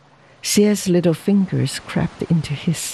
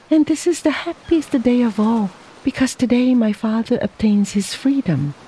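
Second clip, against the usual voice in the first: higher voice, a touch of happiness; lightly acted wife, gladly lifting her pitch; understated joy; 6 semitones higher than usual. Wife tells husband of her father's freedom, understated joy.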